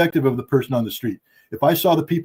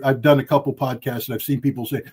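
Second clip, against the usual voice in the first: usual speaking voice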